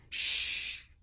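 soft voiceless shushing; soothing hush. A narrator's reading. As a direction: hush a crying child softly. Mother hushes her crying daughter.

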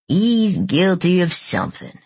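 slow, drawled lawman impersonation; Sheriff King. A narrator's reading. Lance mimics the sheriff to Morgan, slow drawling impersonation. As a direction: draw the words out like a country sheriff. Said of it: "dropped his voice two octaves and drawled".

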